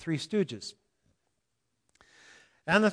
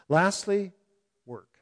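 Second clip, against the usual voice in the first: usual speaking voice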